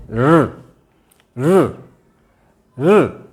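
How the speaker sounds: र said from the मूर्धा, held and repeated; correct retroflex ऋ. Shown as a demonstration. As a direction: sound र with the tongue at the मूर्धा. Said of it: "इसका सही उच्चारण है". (मूर्धा से शुद्ध उच्चारण)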